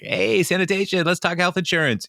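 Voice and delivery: high-pitched hearty greeting; mock-cheerful boss hailing the staff; chipper boss. Boss greeting sanitation staff, chipper.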